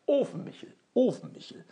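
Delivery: lauter Ruf, fallend; rufend. Acted. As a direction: stellt sich rufend vor. (Ofenmichel ruft seinen Namen durchs Schloss, laut)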